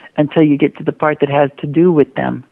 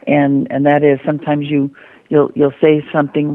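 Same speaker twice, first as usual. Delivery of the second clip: ordinary talk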